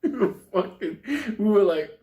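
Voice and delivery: high, loud talk through laughter; laughing. Real, not acted. Storyteller can't get through the midnight wait, laughing. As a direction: try to speak while cracking up.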